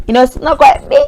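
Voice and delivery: ordinary talk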